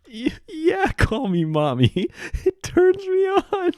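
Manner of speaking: very high, silly seductive squeal; hammed-up mommy roleplay. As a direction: play the flirty mommy, squeaky and absurd. Hammed-up raunchy scammer roleplay.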